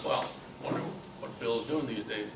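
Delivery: usual speaking voice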